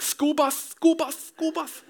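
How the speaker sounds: "School bus" with a lisp, bus said "buth", high; toddler lisp. Retold or imitated. Father imitates son Reese's lisp. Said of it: "He couldn't pronounce his S's".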